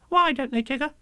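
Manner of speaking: put-on high squeaky child question; little Roo. Roo asks Tigger, high squeaky. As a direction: ask wide-eyed.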